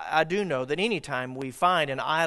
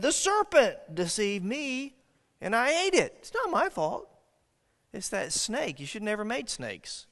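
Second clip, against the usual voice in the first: high, pleading it's-not-my-fault voice; Eve's high defensive excuse; 6 semitones higher than usual. Acted. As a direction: squeak out the blame on the snake. Eve blaming the serpent.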